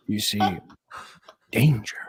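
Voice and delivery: low villain voice with long pauses; Joker. Shown as a demonstration. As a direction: menace them, one piece at a time. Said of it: "yeah, the Joker voice".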